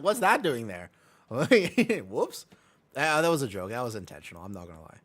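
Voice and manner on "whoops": high, loud giggle into the word; laughing. Real, not acted. Streamer caught with a joke tab, laughing. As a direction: crack up, then toss out the word.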